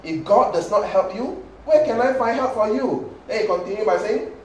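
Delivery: the king declaiming loudly, pitch up; king's reply. Acted. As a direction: proclaim it like a pious king. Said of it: "It says what?".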